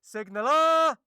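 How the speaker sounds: high-pitched bellow for the signaller; retold shouted summons; officer's shout. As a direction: imitate an officer yelling for his man. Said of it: "and the officer's shouting".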